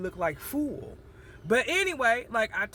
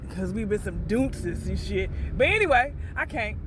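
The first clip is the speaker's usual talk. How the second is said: usual speaking voice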